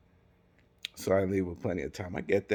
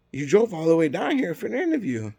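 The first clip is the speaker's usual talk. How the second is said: high, loud mimicked protest; hammed-up incredulous manager; imitated hiring manager; 9 semitones higher, 6 dB louder than usual. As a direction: mimic the manager, pitched up and louder. Voicing manager reluctant to dismiss him.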